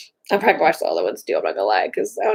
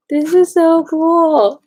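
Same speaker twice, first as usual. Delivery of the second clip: slow, higher and louder delight; thrilled; 8 semitones higher, 6 dB louder, much slower than usual. Real, not acted. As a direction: draw it out high and loud, thrilled. Admiring the shop's products.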